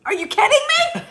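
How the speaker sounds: very high, loud mock disbelief; incredulous yell, half laughing; exasperated outburst. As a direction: burst out in a hammed-up squeal of exasperation. Acted exasperated outburst at husband.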